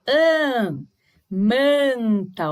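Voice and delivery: "an" alongado pelo nariz, depois o mesmo som em "manta"; som nasal. Shown as a demonstration. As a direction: segure a vogal nasal de "an" e de "manta". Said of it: "que a gente fala nasal". ("manta" com AN nasal bem esticado)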